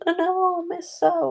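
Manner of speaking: put-on very high whiny little-kid pleading; mock child begging the caretaker; scared kids. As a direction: squeak it like a frightened child. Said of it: "they'd be like".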